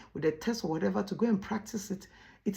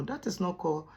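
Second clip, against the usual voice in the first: usual speaking voice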